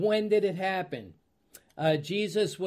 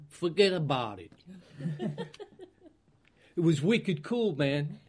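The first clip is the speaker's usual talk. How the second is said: light put-on Boston accent, playful; mock regional accent. Preacher shows his class how a Boston accent gives you away. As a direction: toss off a quick jokey Boston accent. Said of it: "She recognized the accent".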